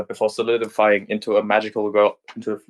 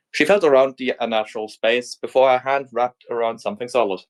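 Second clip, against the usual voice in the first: plain reading voice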